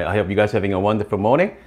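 normal talking voice

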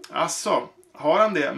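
ordinary delivery

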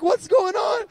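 high, loud exclamation; excited shout. Retold or imitated. Husband reacting to pregnancy news, high loud shout. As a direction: shout it out, stunned.

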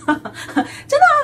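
laughing it out high and slow; amused laugh. Real, not acted. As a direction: giggle through the words. Laughing at her own joke.

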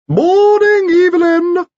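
loud falsetto greeting; extraordinarily high-pitched voice. A narrator's reading. Visitor calling through the door, extraordinarily high-pitched. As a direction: call out in a piping falsetto, very high. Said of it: "said an extraordinarily high-pitched voice".

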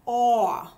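"aw" drawn out as an open aw vowel; vowel sound aw. Shown as a demonstration. Teacher models "aw" sound for young readers. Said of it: "it makes the sound".

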